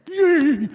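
high, loud yelp of fright; startled squeal. Acted. Passer-by startled by a hello in the dark, high squeal. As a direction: squeal as if someone just made you jump. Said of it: "you get so startled".

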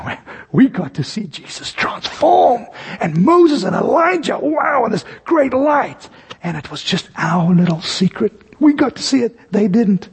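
lightly mocking, self-satisfied brag; smug disciples. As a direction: gloat about their secret like proud schoolboys. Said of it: "they're pretty smug".

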